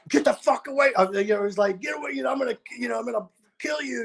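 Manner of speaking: high mock-tough voice imitating the tech; lightly voiced threatening roadie; mock threat. Retelling the stage tech's threat, high mock-tough voice.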